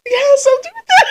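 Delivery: high, strained self-imitation; squeaky attempt to sound unbothered; voice giving way. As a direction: imitate a voice slipping while near tears. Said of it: "that's the only time my voice kinda goes".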